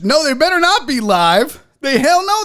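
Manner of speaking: loud, high-pitched disbelief; comic alarm at a nasty surprise; alarmed protest. Real, not acted. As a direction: protest loudly, pitch leaping up. Alarmed the crawfish might be live.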